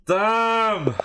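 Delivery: loud, high, drawn-out cry of dismay; high exasperated outburst; frustrated shout. Real, not acted. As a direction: yell it out as the clock runs out. Time ran out in the game.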